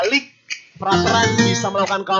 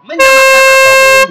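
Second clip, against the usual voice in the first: excited shout; 13 dB louder, slower than usual. Real, not acted. Live commentary, excited peak.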